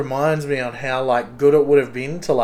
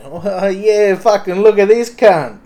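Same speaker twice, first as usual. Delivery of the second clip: higher, louder broad Aussie jeer; mock ocker Aussie; 7 semitones higher, 7 dB louder than usual. Aussie bloke mocking someone, broad Aussie jeer. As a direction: play the rowdy Aussie larrikin. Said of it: "act like a normal Aussie".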